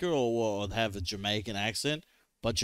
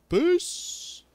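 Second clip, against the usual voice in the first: high airy voice for the word peace; hammed-up little peace, much higher; mock peaceful farewell; 5 dB louder than usual. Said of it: "They're all like".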